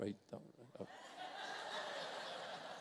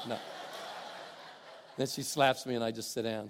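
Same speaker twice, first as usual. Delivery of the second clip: ordinary talk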